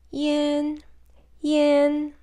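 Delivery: Yan held high and level, first tone. Shown as a demonstration. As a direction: sing Yan on one high flat pitch. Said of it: "in the first tone".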